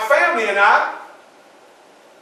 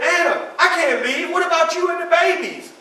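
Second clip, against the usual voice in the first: worried protest; 11 semitones higher, 3 dB louder than usual. Acted. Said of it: "I said".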